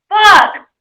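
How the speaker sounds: loud startled swear; shocked yell; shouted curse. Real, not acted. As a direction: blurt it out in shock. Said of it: "I shouted".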